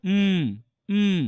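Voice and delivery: উম as a held closed-lip hum; phonetic m. Shown as a demonstration. Teacher shows the phonetic symbol's sound, hummed উম. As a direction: hum উম on its own, lips shut. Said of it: "এটা IP ক্যারেক্টার্সে কি করে?".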